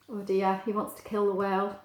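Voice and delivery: ordinary delivery, nothing marked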